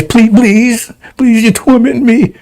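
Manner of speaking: high, slow whimpering please; mock pleading. Preacher acting the demon begging not to be tormented, high and slow.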